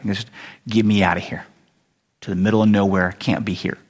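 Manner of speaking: a downbeat fugitive, pitched low; weary runaway. Acted. Acts Jonah fleeing, blues-song remark.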